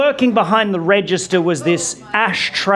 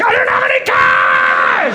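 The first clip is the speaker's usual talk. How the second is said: exasperated shout; 4 semitones lower than usual. Retold or imitated. Comic shouting back at the cashier, fed up. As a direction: yell it like you've had it with her. Frustrated repeat of own line.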